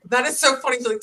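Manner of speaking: usual speaking voice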